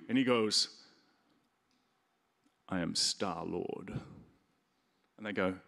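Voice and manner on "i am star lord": slow, low, puffed-up hero voice; grand self-announcement. Retold or imitated. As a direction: draw it out like a movie hero. Peter Quill announcing himself grandly.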